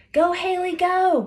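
high, loud cheer from below; a best friend's high, loud cheer. A narrator's reading. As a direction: cheer her on from the ground. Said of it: "Graceland cheered from below".

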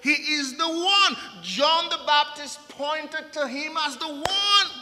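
high, loud, proclaiming to the church; full-force preaching. Acted. Pastor proclaiming Jesus to the congregation, loud preaching.

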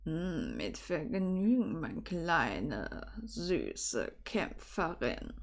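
low, slow, very soft seductive murmur; deep, hushed, pleased growl; growling Severus. A narrator's reading. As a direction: purr it deep and drawn out. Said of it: "Ein Knurren erklang aus Severus".

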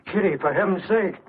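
a stunned man's high plea; shocked plea. Acted. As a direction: beg her to stop, aghast. Shocked man pleads.

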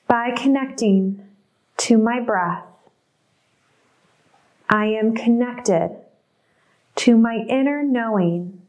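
slow, measured mantra with pauses between phrases; intention recitation. Acted. Recites group intention three times.

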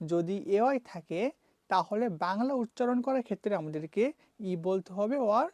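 ordinary talk, nothing marked

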